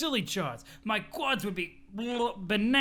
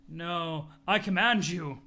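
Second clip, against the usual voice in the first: ordinary talk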